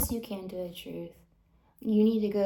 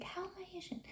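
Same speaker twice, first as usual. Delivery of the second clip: incredulous; 9 semitones higher, 17 dB quieter, faster than usual. Retold or imitated. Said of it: "I'm like".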